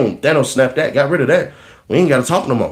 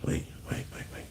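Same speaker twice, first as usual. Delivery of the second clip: whisper; 22 dB quieter than usual. Real, not acted. Sudden hushed aside.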